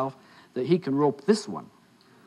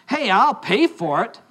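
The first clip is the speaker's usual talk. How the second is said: slow, higher, emphatic comeback; retold boyfriend pushing back; indignant protest; 5 semitones higher, 3 dB louder, slower than usual. Boyfriend arguing with waitress over syrup, indignant.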